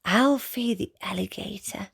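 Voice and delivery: breathy whisper of the title. Shown as a demonstration. Whispering the title to a child at bedtime. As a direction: hush it like a secret to the child. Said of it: "whispered title".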